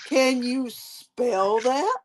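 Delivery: slow, deliberate "Can you spell that?"; slow teacher question. Retold or imitated. Teacher challenging a child, slow and deliberate. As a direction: ask it slowly like a teacher. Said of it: "what their response was".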